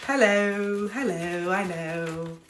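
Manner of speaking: badly faked British greeting; British accent. Greeting viewers from Britain in mock British accent. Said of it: "that's not even an accent".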